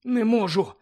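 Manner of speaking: struggling to stay afloat; Harry gasping while treading water; out of breath. Acted. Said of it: "задихався Гаррі".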